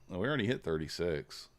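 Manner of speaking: ordinary talk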